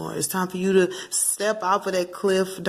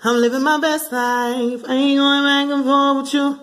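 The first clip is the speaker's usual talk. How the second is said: higher and slow, sing-song brush-off; sassy dismissal; 7 semitones higher, slower than usual. Pretend. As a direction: wave them off with attitude. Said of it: "Tell them".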